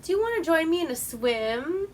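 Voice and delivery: high, coy invitation; mock fifties beach girl inviting a swim.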